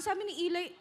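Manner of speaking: usual speaking voice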